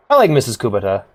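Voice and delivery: ordinary delivery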